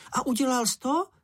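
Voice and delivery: very high-pitched little character; high character voice, questioning; Čenich's eager question. A narrator's reading. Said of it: "zeptal se Čenich".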